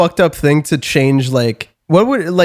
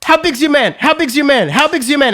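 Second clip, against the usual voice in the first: imitating an aggressive guy, high-pitched repeats; insistent badgering; 8 semitones higher than usual. Stranger slapping a guy's arm while asking, insistent. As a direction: hammer the same question, mock aggressive. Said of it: "he kept going".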